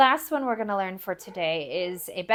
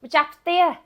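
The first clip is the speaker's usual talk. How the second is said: louder, higher model call; called out for repetition; 8 semitones higher, 10 dB louder than usual. Shown as a demonstration. Teacher calls it out for students to repeat, loud. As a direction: call it out clearly for learners to repeat. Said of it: "I'll say it. You repeat after me".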